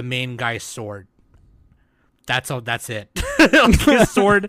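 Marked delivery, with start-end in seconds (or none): 3.5-4.5 high, loud laughing excitement; excited laugh. Real, not acted. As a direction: gush about it laughing. Laughing excitement about sword.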